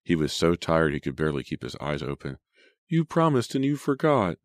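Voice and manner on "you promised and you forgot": put-on high, plaintive little-boy complaint; pouting, high-pitched whine; whining child. A narrator's reading. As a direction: accuse big sister like a let-down child. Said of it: "he whined".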